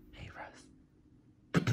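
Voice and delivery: soft whisper so he won't wake. Real, not acted. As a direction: barely breathe his name. Whispering to sleeping husband.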